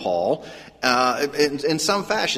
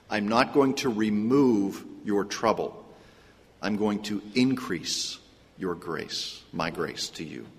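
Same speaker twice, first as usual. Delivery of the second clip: hushed repeat; 5 semitones lower, 6 dB quieter than usual. Retold or imitated. Preacher re-voicing God's answer to Paul, low and hushed. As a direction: drop lower and softer to let it sink in.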